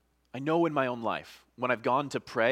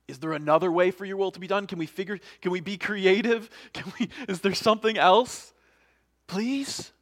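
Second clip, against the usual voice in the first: high, urgent, louder questions; pleading bargaining; 7 semitones higher, 6 dB louder than usual. Acted. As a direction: scramble for alternatives, ending in a begging please. Acts Jesus wrestling, searching for options.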